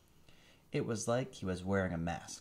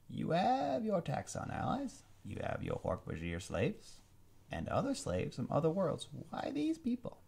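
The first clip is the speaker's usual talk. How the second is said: a hint of defiant prince, higher at times; lightly voiced alien prince; 10 semitones higher than usual. Captured prince questioning the villain, lightly voiced. Andalite voice.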